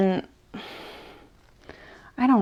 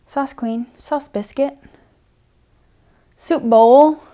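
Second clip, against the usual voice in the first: doting pet names; 5 semitones higher, 5 dB louder, slower than usual. Real, not acted. Cooing pet names at the dog.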